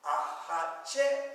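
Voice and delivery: A hot check slow and loud, check stressed and rising; teacher confirming the accent. Shown as a demonstration. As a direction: land hard on check. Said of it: "Is it on the third syllable?".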